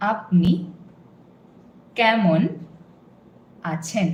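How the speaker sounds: each word said slowly apart. Shown as a demonstration. Teacher spelling out each word for learner. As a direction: space out every word clearly. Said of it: "See if you can hear all the three words distinctly".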